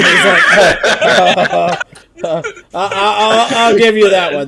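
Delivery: loud, laughing stammer; the gnome cracking up, barely getting words out. Acted. Gnome to the player, breaking up with loud laughter.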